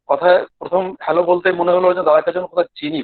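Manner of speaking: ordinary talk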